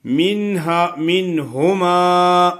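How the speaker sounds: منہما with a clear non-nasal ن before ه, slowly; no nasal ghunna. Shown as a demonstration. Teacher models منہما without ghunna. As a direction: say منہما with ن cleanly sounded, no ghunna. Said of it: "من پہ غنہ نہیں ہوگا".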